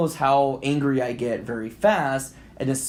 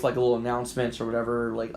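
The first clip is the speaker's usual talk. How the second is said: normal talking voice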